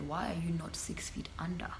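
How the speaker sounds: a scornful whispered question; whispered scorn at a clingy guy. Real, not acted. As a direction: breathe it out with contempt. Mock-hushed scorn.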